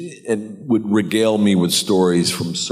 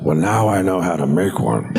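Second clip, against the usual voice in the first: lightly voiced Pacino, laughing; a hint of proud Pacino; Pacino impression.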